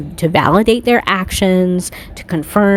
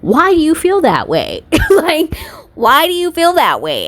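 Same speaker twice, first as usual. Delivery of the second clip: incredulous; 8 semitones higher than usual. Real, not acted. Incredulous challenge.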